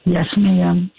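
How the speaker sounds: a bit hoarse; talking with a raspy throat. Real, not acted. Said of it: "Excuse my raspy voice".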